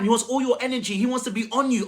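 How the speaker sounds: usual speaking voice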